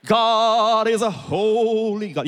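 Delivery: high, slow, drawn-out pious intoning; solemn holy tone. Acted. Objector challenging the pastor on God's holiness, mock-solemn drawl. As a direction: stretch it out like a sanctimonious objector. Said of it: "say it in that kind of tone".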